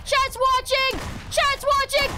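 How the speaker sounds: high, loud frantic yelling; panicked screaming. Real, not acted. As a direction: scream like you're about to lose in front of chat. Panicked screaming while playing.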